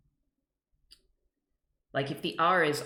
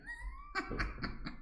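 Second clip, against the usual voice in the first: squealing laugh; 10 dB quieter than usual. Real, not acted. Joke about drinking.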